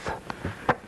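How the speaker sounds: voiceless TH, only air as in thumb; unvoiced th. Shown as a demonstration. Teacher repeats the TH with no voice sound, voiceless TH. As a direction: blow TH softly without voice. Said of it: "there's no voice sound".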